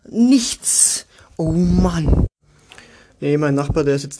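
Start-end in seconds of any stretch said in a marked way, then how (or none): 0.1-2.3 drawn-out whiny groan; bored lockdown whine; exaggerated moan. Real, not acted. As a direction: moan about having nothing to do.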